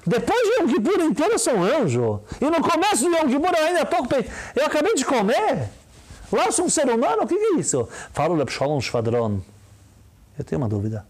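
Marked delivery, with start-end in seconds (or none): 0.1-7.9 protesting congregant. Retold or imitated. Fiel protestando, voz imitada.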